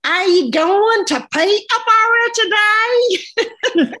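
laughing English accent imitation of a conductor asking about Peterborough. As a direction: ham up a chirpy English conductor while laughing. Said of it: "the conductor would say".

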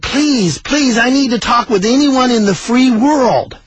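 imitated desperate caller, slowly imploring; pleading caller. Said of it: "I heard a voice kind of behind the operator".

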